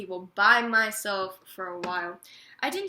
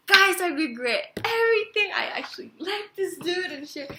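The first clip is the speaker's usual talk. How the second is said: high, loud gushing to friends; excited confession; 8 semitones higher, 8 dB louder than usual. Retold or imitated. Telling her friends she regrets it, gushing loudly. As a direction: blurt it out to the group, thrilled.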